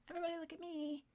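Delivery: mocking attention-seeker. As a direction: whine it like a needy child begging for eyes. Second take, mocking attention seekers.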